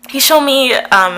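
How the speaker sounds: usual speaking voice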